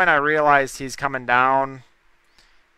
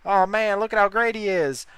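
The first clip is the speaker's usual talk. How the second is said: mock fan; 8 semitones higher than usual. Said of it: "I'm not Ray Alfala".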